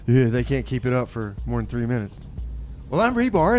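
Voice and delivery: normal talking voice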